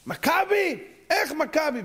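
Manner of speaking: high-pitched incredulous cry; scandalized, high outburst; appalled disbelief. Acted. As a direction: exclaim it with the pitch way up. Appalled at the team name.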